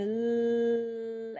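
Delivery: long steady hummed "l-"; L sound. Shown as a demonstration. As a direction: hold "l-" on one note. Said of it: "put your tongue tip up".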